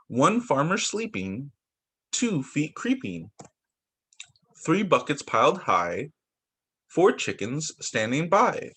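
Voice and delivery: slow counting-book read, each number set apart; reading to children. Acted. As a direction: count it out gently for the little ones. Reads counting book aloud.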